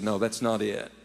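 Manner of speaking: lightly voiced tearful wife, soft and low; a hint of a wife holding back tears; quiet, near-tearful confession; crying wife. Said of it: "And she started crying".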